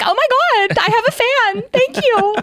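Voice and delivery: replaying her flustered high reply; high gushing voice. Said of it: "nor does my voice usually go up that high".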